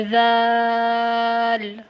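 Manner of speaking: ذال with a soft "th" buzz, held long; drawn-out soft ذال; soft dhal. Shown as a demonstration. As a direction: stretch the vowel of ذال after a gentle dh. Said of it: "ایک کو آپ لوگوں نے نرمی سے پڑھنا ہے".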